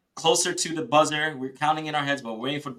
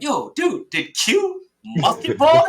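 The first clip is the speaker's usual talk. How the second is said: teammate yelling the question, higher and louder; loud excited yell, pitched up; excited shout; 8 semitones higher, 6 dB louder, slower than usual. Retold or imitated. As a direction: shout it out in disbelief. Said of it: "was like".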